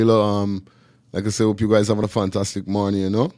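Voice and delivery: ordinary talk, nothing marked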